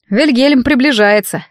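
plain reading voice

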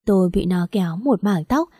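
ordinary delivery

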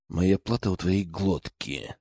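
low, slow soft growl; growling bugbear. A narrator's reading. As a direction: growl the threat back. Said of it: "рыкнул я в ответ".

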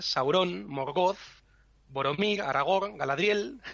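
Sauron, Morgoth, Boromir, Aragorn, Galadriel each stressed on the last syllable; wrong final stress. Shown as a demonstration. Teacher recalls beginners' misreadings, Sauron, Morgoth, Boromir, Aragorn, Galadriel stressed on their last syllables. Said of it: "todo lo que nosotros decíamos cuando empezábamos a leer".

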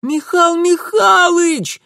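очень высокий радостный визг, протяжно; завопить от восторга, девичьим голосом; радостный вопль. A narrator's reading. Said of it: "радостно завопила Даринка". (радостный девичий вопль)

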